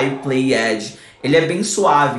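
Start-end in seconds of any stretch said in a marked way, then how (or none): 0.0-1.0 "played" as two syllables, PLAY-EDGY; wrong -ed ending. Shown as a demonstration. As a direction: mispronounce "played" with a separate -ed syllable. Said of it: "a gente nunca vai falar".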